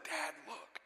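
put-on a little boy's hushed "Dad, look"; breathy whisper tugging at dad; whispered child's voice. Son pointing out the lingerie, whispered to dad. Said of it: "And Silas said".

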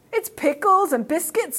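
high, slow piranha complaint; rude, ungrateful. A narrator's reading. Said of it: "very rude and ungrateful".

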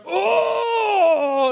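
very high, loud, sustained mock yell; mock flexing roar. Second guy turns to flex his back, hammed-up roar. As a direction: strain out a bodybuilder's roar.

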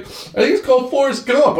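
very high-pitched mock-tearful voice; hammed-up crying man; imitating bawling. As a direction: blubber it out in a squeaky wail. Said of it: "he's just bawling".

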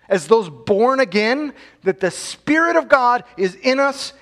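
fiery sermon climax, building; preaching at full force. Acted. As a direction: hammer the victory home from the pulpit.

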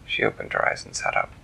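plain reading voice

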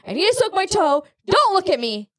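high-pitched mock tantrum; retold anger. Mom re-enacts being mad about her toe, mock anger. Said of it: "I was so mad about my toe".

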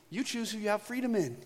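ordinary talk

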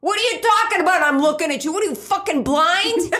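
high, hammed-up outraged yelling; mock Italian fight. Acted Italian family quarrel, reply.

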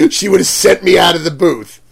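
ordinary talk, nothing marked

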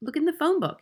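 high schoolboy voice; high-pitched, insistent pupil; Eric. A narrator's reading. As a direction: answer back as a young boy. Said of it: "Eric said".

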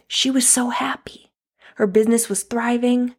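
barely holding it together while quoting him; real emotion, voice cracking to a whisper; tearful whisper. Real, not acted. Retelling grieving partner's words.

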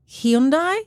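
"Hyundai" as a questioning two-beat guess, rising; unsure pronunciation. Shown as a demonstration. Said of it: "We all say hi to Hyundai in Australia".

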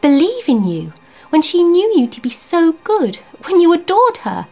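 high, loud disbelief; astonished that he'd ask; wife amazed at the question; incredulous. Acted. Wife indignant, surprised.

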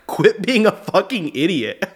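loud, high mock-exasperated outburst; amused imitation of irritation; irritated order. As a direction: retell an annoyed scolding, on the edge of laughter. Sleep-talker snaps at girlfriend, retold laughing.

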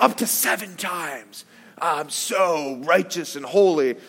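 lightly sends up Peter's smug pride; a hint of a pompous disciple; smug self-righteous Peter. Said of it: "this just shows the arrogance of Peter".

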